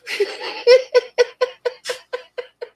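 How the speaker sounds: real helpless laugh, very high; high burst of laughter. Real, not acted. Cracking up at the kid.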